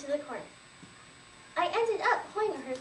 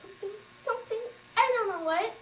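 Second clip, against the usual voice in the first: frightened, rising outburst; panicked mother; 7 semitones higher than usual. Acted. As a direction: sputter it out in alarm. Panicked mother stammers answer.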